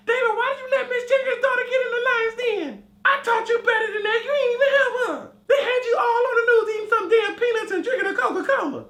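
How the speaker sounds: a mama half crying, half laughing as she chews him out; scolding mama. Retold or imitated. Mama scolding son over phone, shrill wailing. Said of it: "my mama's gonna be calling me".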